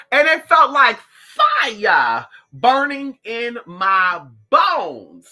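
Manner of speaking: slow, loud, testifying; fervent slow testimony. Real, not acted. Vlogger feeling spirit, slow fervent testimony. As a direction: testify like at church.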